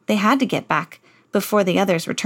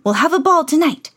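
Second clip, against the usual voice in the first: high, loud eager royal voice; excited king; 7 semitones higher, 4 dB louder than usual. Acted. King orders a ball to the Duke, high and eager. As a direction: announce it like a giddy old king. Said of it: "shouted the king".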